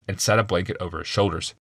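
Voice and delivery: plain reading voice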